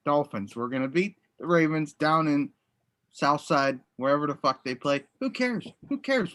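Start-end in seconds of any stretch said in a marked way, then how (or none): 5.2-6.3 dismissive. Real, not acted. Taunting dismissal.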